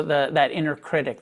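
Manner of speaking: ordinary delivery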